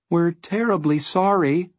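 high, meek little apology. A narrator's reading. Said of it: "said Milo meekly".

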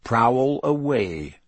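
drawn-out veiled threat; menacing. A narrator's reading. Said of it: "but his voice was full of menace".

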